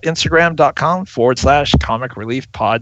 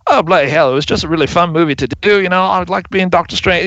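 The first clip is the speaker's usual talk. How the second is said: higher-pitched mock British actor voice; imitated British accent; 7 semitones higher, faster than usual. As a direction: put on a British accent, high and quick. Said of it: "that's a good accent".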